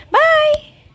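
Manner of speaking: playful squeal of a goodbye; cutesy squeaky farewell; squeaky high bye. Pretend. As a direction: sing out a tiny high-pitched bye.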